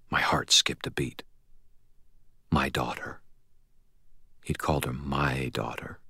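soft, near-whispered realisation; hushed awe. A narrator's reading. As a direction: let it sink in under your breath. Stunned narrator absorbs the revelation.

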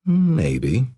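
echoing her coy tone. Retold or imitated. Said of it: "I said in the same tone she'd used earlier".

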